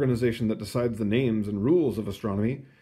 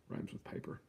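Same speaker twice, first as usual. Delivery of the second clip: breathy whispered aside; 19 dB quieter than usual. Acted. Reader whispering a rhyme aside to the kids. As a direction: lean in and whisper it.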